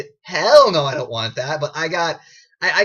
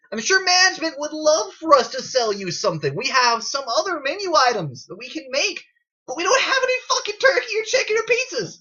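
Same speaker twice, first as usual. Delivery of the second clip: sarcastic rant; 9 semitones higher than usual. Retold or imitated. Ranting about customers to viewers.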